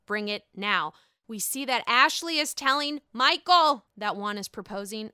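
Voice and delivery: ordinary talk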